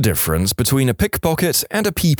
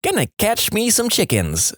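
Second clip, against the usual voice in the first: put-on high-pitched child voice; kid; 10 semitones higher than usual. A narrator's reading. Kid answers old man on porch, high child voice. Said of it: "says the kid".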